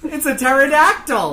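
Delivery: high excited shout. Acted. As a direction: blurt it out amazed. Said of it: "[name] explains".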